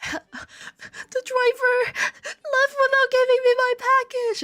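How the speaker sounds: very high, drawn-out fake crying whine; squeaky mock sobbing; mock tearful complaint. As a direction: wail it like a hammed-up tattletale. Acted complaint call to post office.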